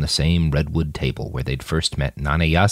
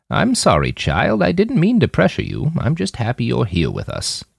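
gentle apology; 7 semitones higher than usual. A narrator's reading. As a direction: ease off gently so she isn't frightened. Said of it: "favored the girl with a warm smile".

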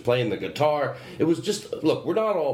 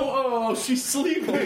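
much higher, cooing over a sleeping pet; 12 semitones higher, much slower than usual. Real, not acted. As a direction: coo it as if to a sleeping puppy.

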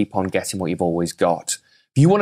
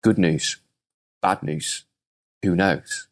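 a hint of a low, unbothered old man; lightly voiced wise old rancher; calm old farmer; 4 semitones lower, slower than usual. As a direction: say it slow and level, unmoved. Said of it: "And the old boy goes".

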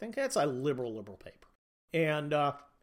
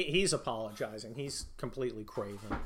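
ordinary delivery

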